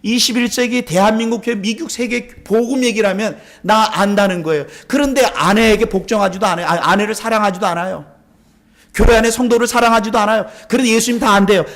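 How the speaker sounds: high, loud rebuke from the pulpit; forceful preaching. Real, not acted. Preacher rebukes church at full force. (목소리 높여 질타하는 설교)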